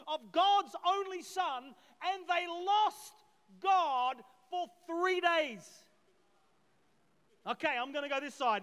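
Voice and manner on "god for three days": slow, heavy stress on the words; emphatic. Real, not acted. Preacher pounding the point to church, slow and heavy. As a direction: lean hard on God for three days.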